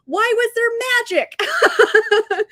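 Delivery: slow, high, laughing disbelief. Retold or imitated. Reader laughing at the book's magic, slow and high. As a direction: drag it out through a laugh.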